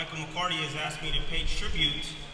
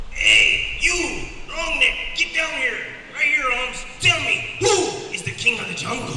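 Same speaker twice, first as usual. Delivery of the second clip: cocky lion; 10 semitones higher, 7 dB louder, slower than usual. Acted. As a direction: swagger like a street-tough lion, chest out. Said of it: "He looks up".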